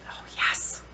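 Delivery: breathy whispered yes. Real, not acted. Sniffing vanilla mint, whispering approval. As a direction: whisper it, blissed out.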